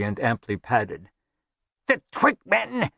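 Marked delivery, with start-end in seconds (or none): 1.9-2.9 high cry of alarm; panicked. A narrator's reading. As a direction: warn of attackers in a high voice. Chimwazzle panics over his attackers.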